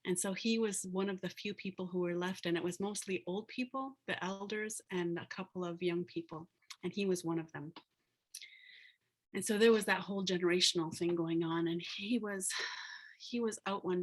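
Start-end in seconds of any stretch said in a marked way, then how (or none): none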